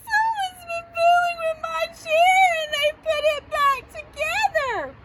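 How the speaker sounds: high, loud screeching tantrum; Baby Bear. Acted. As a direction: screech and wail like an outraged cub. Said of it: "Baby Bear screeched".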